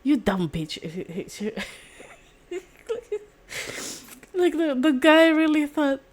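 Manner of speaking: can barely keep it together; laughing. Real, not acted. Vlogger cracking up while explaining the skaters' rivalry. Giggling through the retelling.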